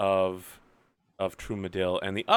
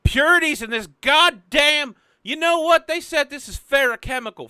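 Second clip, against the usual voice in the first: frantic yell; 14 semitones higher, 7 dB louder than usual. Acted. Frantic character yelling about purity.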